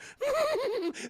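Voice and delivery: squeaky Yoda cackle; high mocking cackle. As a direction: cackle at him like a tiny gremlin. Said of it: "starts laughing at him".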